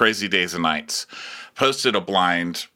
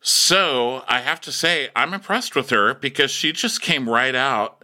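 usual speaking voice

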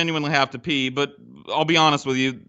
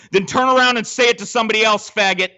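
higher, louder mock confrontation; retold anger; 6 semitones higher, 4 dB louder than usual. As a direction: get in his face, retelling it. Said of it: "and I said".

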